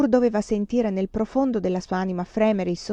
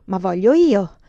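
sudden loud outburst at usual pitch; grido improvviso; 7 dB louder than usual. A narrator's reading. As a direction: burst out, overruling the refusal. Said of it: "gridò a un tratto Kamarowski". (grido improvviso e imperioso)

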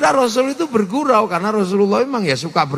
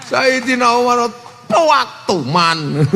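laughing; slower than usual. Real, not acted. Preacher laughs at own joke.

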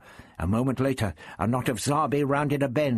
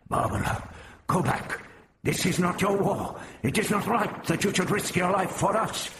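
earnest, higher-pitched character voice; urgent plea; 7 semitones higher than usual. A narrator's reading. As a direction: plead with her to turn back. Hrostar urging Barbara to turn back.

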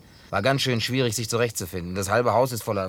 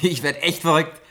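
thrilled; 6 semitones higher, 5 dB louder than usual. Acted. Overjoyed at gift, repeats louder.

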